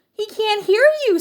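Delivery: high, loud singsong taunt; lilting high kid-sister voice. A narrator's reading. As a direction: chirp it like little sisters. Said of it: "sang his sisters".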